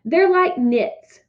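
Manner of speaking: usual speaking voice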